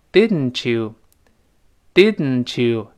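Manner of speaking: "didn't you" very slowly, t and y merging into ch; linked didn't you. Shown as a demonstration. Teacher shows the sound change, slow "didn't you" with a ch. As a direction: say "didn't you" as didn-chew, drawn out. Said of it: "一定变成ch".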